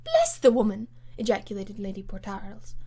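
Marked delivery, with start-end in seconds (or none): 0.0-0.9 loud hearty outburst; exasperated exclamation. Acted. Lady Portarles exclaims at the Comtesse, loud and exasperated. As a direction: throw up your hands.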